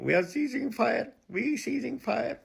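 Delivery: high, slowing mock plea; mocking the government. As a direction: squeak out the surrender in a mock voice. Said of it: "government saying".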